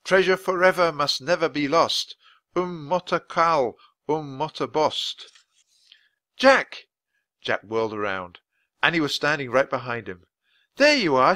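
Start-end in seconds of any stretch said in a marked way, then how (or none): 0.0-5.3 put-on boy shouting a magic rhyme into the wind; shouted rhyme. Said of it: "Jack found the rhyme and shouted". 6.4-6.9 high, loud call of a name; girl shouting her brother's name. Acted. Annie calls out behind him. 10.8-11.3 relieved call. Acted. Annie relieved, finds Jack.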